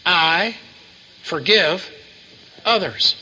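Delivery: I ... forgive ... others with a pause after each word; deliberate emphasis. Shown as a demonstration. Preacher correcting the congregation, each word set apart. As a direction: space out I, forgive and others to weigh each one. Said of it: "I said".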